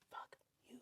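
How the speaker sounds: barely audible unvoiced curse; whisper. Real, not acted. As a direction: breathe the curse almost silently.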